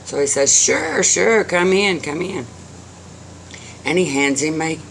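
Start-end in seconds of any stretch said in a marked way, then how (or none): 0.7-2.5 higher-pitched, brisk invitation inside; welcoming old man. A narrator's reading. Old man lets his visitor in, welcoming. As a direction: wave a visitor in, voice lifted.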